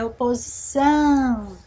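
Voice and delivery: slow "Oposição" with a long ÇÃO; stress on last syllable. Shown as a demonstration. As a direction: lean on and stretch the final ÇÃO of "Oposição". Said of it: "É são".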